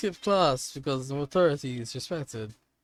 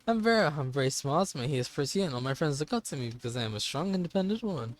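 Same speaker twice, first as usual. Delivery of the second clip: ordinary talk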